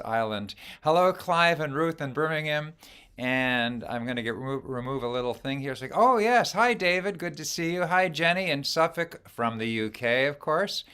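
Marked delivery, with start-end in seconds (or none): none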